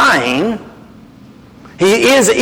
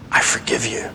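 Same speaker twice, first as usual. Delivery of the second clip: soft unvoiced whisper, low and gentle; whispered forgiveness; 9 semitones lower, 9 dB quieter than usual. Preacher voicing Jesus forgiving the listener, whispered. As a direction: breathe it like a tender absolution. Jesus quoted tenderly in sermon.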